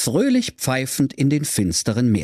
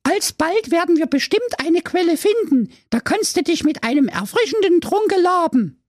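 put-on much higher lofty girl voice; little sister. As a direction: speak like a fairy tale princess. Sister figure.

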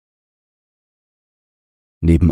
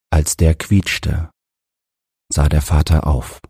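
ordinary delivery

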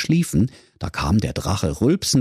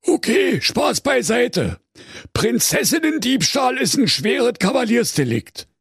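high-pitched zealous commissioner; high Berlin cop on duty; officious zeal. A narrator's reading. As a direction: declare the offence with official eagerness. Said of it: "rief der Kommissar diensteifrig".

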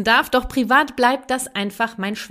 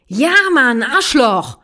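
put-on much louder, higher childish yell; angry child shout; 4 semitones higher, 8 dB louder, slower than usual. Mother acts the child shouting a curse at her, furious. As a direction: bark the swear word at the parent.

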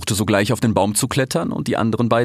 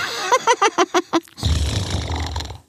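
long, high staccato cackle; hyena cackle. A narrator's reading. Hyena laughs up at the fox, high rattling cackle.

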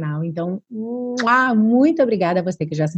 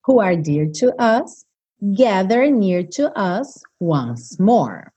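slow lyric reading; much slower than usual. Shown as a demonstration. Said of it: "teacher reads lyrics slowly".

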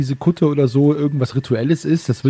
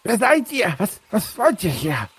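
higher, wary cult priest; cultist voice; 8 semitones higher than usual. Acted. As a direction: challenge the strangers suspiciously.

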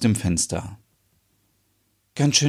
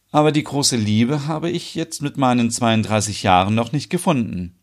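ordinary talk

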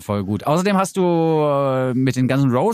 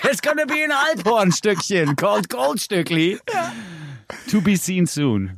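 announcer voice; 7 semitones higher than usual. Pretend. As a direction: tease it like a movie trailer. Mock announcement of alphorn video.

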